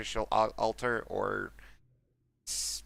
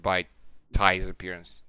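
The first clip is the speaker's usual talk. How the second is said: normal talking voice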